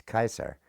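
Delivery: Kaiser as Latin Caesar, KY-sar; hard-C Latin Kaiser, KY-sar; Latin pronunciation. Shown as a demonstration. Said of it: "C is hard in Latin".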